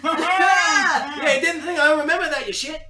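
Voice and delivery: very high, loud laughing squeal; shrieking laugh-talk. Acted. Nick Dick squeals laughing.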